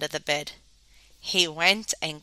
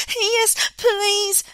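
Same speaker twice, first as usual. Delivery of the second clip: high squeaky little voice; squeak; 11 semitones higher, 4 dB louder than usual. A narrator's reading. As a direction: squeak it out nervously. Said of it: "squeaked Smook".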